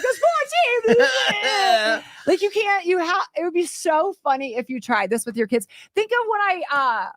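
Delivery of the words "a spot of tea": put-on high, slow, laughing British accent; mock British accent. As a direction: stretch it out in a hammed-up posh British voice. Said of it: "be like".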